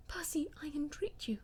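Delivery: breathy, voiceless plea to her husband; hushed entreaty; whispered plea. A narrator's reading. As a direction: whisper it, begging him. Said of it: "she whispered".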